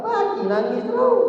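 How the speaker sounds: high-pitched, loud comic outcry; hammed-up high wail of complaint; exasperated mimicry. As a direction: shoot the voice way up in mock exasperation. Mimicking wife's exasperation at constant crying.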